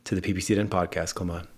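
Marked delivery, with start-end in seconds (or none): 1.1-1.5 Clement said the French way, kleh-MAHN, nasal ending, no final t; soft French Clement, stress on the last syllable; French pronunciation. Shown as a demonstration. Host welcoming the guest from France by name. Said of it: "your name is Clement".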